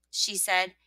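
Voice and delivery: ordinary delivery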